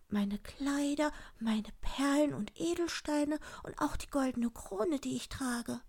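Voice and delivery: high, light princess voice; high-pitched princess listing treasures; pleading princess. Acted. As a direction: offer it all in a high sweet voice. Princess offers her treasures.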